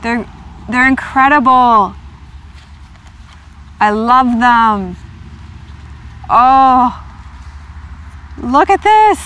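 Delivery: can barely contain the excitement; thrilled exclaiming. Real, not acted. Excited over the deck.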